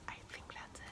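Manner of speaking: barely voiced whisper; very soft whispered aside; whispered self-check. Real, not acted. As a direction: whisper it to yourself, unsure. Checking name to herself.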